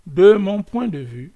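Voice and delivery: de mon point de vue said loudly and insistently; emphatic. Shown as a demonstration. Repeats phrase, then whispers it.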